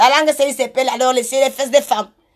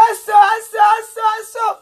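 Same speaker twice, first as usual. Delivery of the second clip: high-pitched mock cry, repeated; imitated calling out; 7 semitones higher than usual. Quoting the man in the gossip, high mock cry. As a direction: mimic someone crying out high, over and over.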